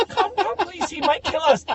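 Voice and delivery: very high mock pleading; frightened sister. Sister fearing the driver might attack, mock-frightened pleading. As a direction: imitate a nervous sister, voice pushed way up. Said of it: "my sister's like".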